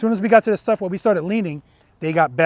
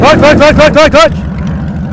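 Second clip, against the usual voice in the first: loud, urgent repeated shout; 8 semitones higher, 7 dB louder, much slower than usual. Real, not acted. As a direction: yell it fast before they stall. Urgent calls to a stalling rider.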